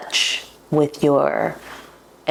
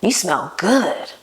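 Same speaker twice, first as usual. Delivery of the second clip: slow mock compliment from a man; 2 semitones higher, slower than usual. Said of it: "this man was like".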